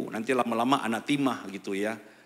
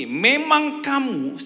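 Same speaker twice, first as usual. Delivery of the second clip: voicing Joseph; 10 semitones higher, 7 dB louder, much slower than usual. Preacher voicing Joseph to brothers.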